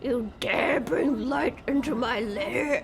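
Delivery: first shaky stab at an evil overlord; Ganon. Acted. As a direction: intone it like a video game villain, about to crack up. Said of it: "I can do the Ganon voice".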